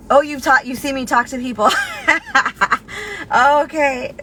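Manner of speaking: ordinary talk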